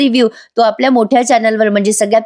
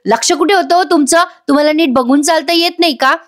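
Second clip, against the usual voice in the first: higher, sharp telling-off; snapping at her; 6 semitones higher than usual. Acted. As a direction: scold the bangle seller from way up. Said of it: "Priya त्या बाईवरच डाफरते".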